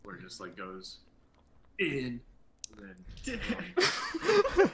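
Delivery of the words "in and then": breaking up, mouth on fire; can't talk for the spice; mouth burning from spicy food. Real, not acted. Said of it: "I can't talk. My mouth is on fire".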